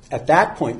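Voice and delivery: ordinary delivery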